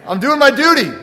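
loud, high, self-satisfied declaration; proud duty. Retold or imitated. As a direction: announce it like a proud chore done. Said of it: "I said".

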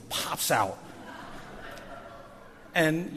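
normal talking voice

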